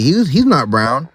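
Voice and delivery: ordinary delivery, nothing marked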